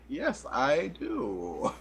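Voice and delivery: very slow, flat-toned reply; deadpan. Retold or imitated. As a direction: drag it out, deadpan. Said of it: "I'm like".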